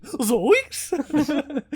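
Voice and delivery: laughing Shaggy impression; high, giggly Zoinks. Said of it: "like a Scooby-Doo episode".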